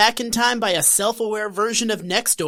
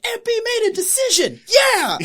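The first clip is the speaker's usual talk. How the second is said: high, loud mock cheer; hammed-up excited cheer; 7 dB louder than usual. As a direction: cheer her on like a fan. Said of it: "we're like".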